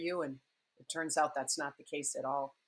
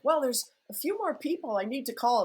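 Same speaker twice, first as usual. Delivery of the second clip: high, whiny stalling excuse; pleading excuse; 9 semitones higher, 4 dB louder than usual. Retold or imitated. Writer stalling his editors, in a high pleading voice.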